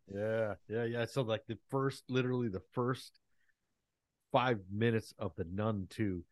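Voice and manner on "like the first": ordinary talk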